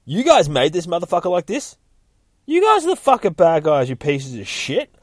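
loud, high acted-out tirade; mock-furious shout that builds; retold screaming. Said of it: "starts screaming at him".

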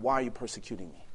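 quick, very soft voice from the light; a hushed divine voice asking why; hushed voice of Jesus. Acted. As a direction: say it low and fast, almost under the breath. Voice of Jesus on the road.

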